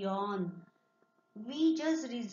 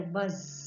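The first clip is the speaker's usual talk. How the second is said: low buzzing bee; 6 semitones lower than usual. Shown as a demonstration. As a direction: buzz it like a bee. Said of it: "Who makes this sound?".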